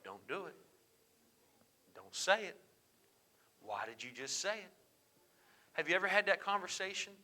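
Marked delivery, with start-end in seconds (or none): 0.0-4.7 softly murmured thought to oneself; hushed self-talk, as if warning yourself; inner voice. Acted. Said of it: "thought to yourself".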